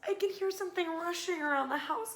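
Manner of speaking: high worried little mouse; small anxious voice. A narrator's reading. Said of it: "called Little Mouse".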